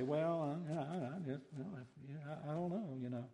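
soft, stammering noncommittal doubt; halting, low-key hemming and hawing; skeptical hedging. Acted. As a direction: stall quietly, reluctant to say you believe it. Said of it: "that they were skeptical".

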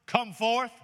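Jesus commanding the dead Lazarus to rise; calling out. Acted. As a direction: call him out of the tomb. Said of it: "And Jesus called out".

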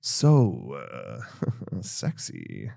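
very low, soft sultry murmur; mock seductive. Stewardess admiring the passenger's voice, low mock-seductive purr. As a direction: drop deep and swoon jokingly. Acting the smitten stewardess, joking.

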